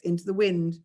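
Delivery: usual speaking voice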